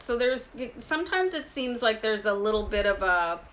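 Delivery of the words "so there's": ordinary talk, nothing marked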